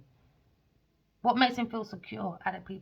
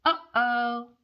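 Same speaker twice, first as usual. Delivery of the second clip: milder uh-oh; 4 semitones higher, 5 dB louder than usual. Acted.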